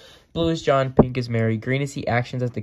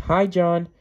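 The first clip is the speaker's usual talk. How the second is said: high, loud answering hello; acted yell, pitched up; yelled greeting; 7 semitones higher, 5 dB louder than usual. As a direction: call back to someone across the schoolyard.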